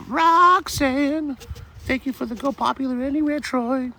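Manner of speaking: very high, drawn-out sing-song thank-you; playful falsetto thanks. Acted. Streamer thanking viewers for a gift, in a squeaky drawn-out falsetto. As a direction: stretch the names out in a silly squeaky voice.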